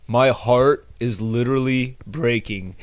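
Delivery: slow, hammed-up heartbroken line; lightly mocking melodrama; mock dramatic heartbreak. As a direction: parody a guy moaning about heartbreak. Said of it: "and he goes".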